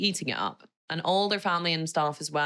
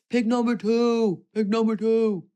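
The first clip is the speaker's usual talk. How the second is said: loud, high, slow chanted movie quote; Shrek quote; 5 semitones higher, 5 dB louder than usual. Acted. Quoting a film for viewers to guess, loud chanted impression. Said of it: "It's from Shrek".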